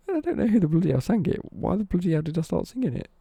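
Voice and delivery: loud, high incredulous retelling; mock bafflement. Retelling the giggle over a mystery song, mock bafflement. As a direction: act out exasperated disbelief, pitched right up.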